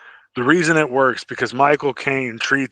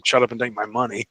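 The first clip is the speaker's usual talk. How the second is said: ordinary talk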